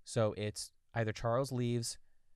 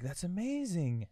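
high, slow acted wonder; hammed-up delighted amazement; amazed; 8 semitones higher, slower than usual. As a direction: marvel at it, drawn out. Acting out amazed reply.

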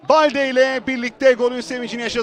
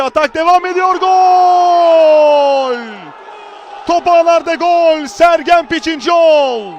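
long roaring goal shout; screaming football commentator; 4 semitones higher, 6 dB louder than usual. Real, not acted. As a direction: belt out the goal and scorer. Goal scored live.

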